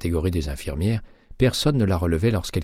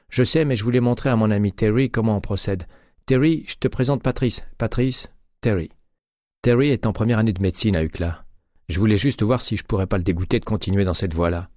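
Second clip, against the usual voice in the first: a hint of a higher, louder casual nurse; breezy offhand voice, slightly raised; lightly voiced Graziella; 5 semitones higher, 3 dB louder than usual. A narrator's reading. Graziella explaining to colleague, lightly voiced casual.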